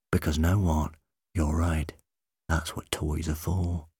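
low, hushed confiding voice; whisper. A narrator's reading. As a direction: softly encourage a child, as if in secret. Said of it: "he whispered".

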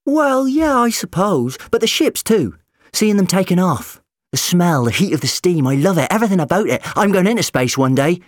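put-on high, loud, eager boy; a young dreamer, loudly resolute; determined. A narrator's reading. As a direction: gush it with fierce conviction. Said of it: "he told them with determination".

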